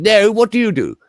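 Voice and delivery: loud, high posh English question; mock a plummy upper-class Englishman; posh English, swooping up high; posh English accent. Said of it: "Bosh git".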